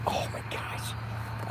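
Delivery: breathy whispered oh my gosh, slow; awed whisper. Real, not acted. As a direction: whisper it as if the food were in front of you. Craving food, gasps in awe.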